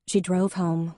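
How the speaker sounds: ordinary delivery, nothing marked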